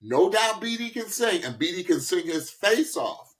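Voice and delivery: ordinary talk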